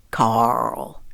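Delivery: low, drawn-out deadpan; dry. A narrator's reading. Daddy guesses who mocks the South, dryly. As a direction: drop low and drag the name out dryly. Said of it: "he said dryly".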